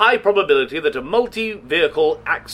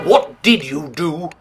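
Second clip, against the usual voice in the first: usual speaking voice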